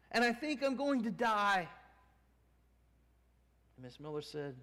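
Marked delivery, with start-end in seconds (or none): 0.1-1.7 despairing woman. Retold or imitated.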